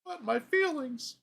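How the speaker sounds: high-pitched mock wail; mock whining. Comic mocking feelings he never got to share, high mock whine. Mocks people talking about feelings.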